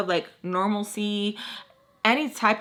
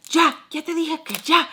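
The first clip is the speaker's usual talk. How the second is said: telling her to stop; 7 semitones higher, 3 dB louder than usual. Retold or imitated. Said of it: "telling her, like, to stop".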